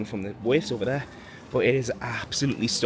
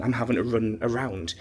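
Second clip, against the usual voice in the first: ordinary talk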